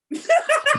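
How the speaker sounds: loud high cackle; laughing at the roast, loud and high. Real, not acted. Big laugh.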